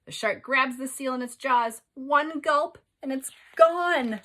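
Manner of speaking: rising excitement, slowed at the end; dramatic build. Shown as a demonstration. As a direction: build the attack to a punch. Climax of shark attack reading.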